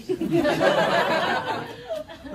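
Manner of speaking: drawn-out high laughter, rising; long high laugh. Real, not acted. Magician teasing the surprise to come, long gleeful laugh.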